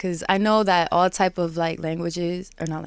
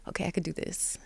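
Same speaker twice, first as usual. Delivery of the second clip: soft unvoiced self-pep talk; hushed self-encouragement; whispered to herself; 2 semitones lower, 12 dB quieter than usual. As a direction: whisper it like a private promise. Self-talk reassurance quote.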